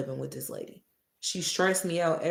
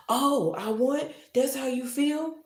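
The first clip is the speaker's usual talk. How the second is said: mock hurt surprise; 9 semitones higher than usual. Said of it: "she wanna play with my emotions".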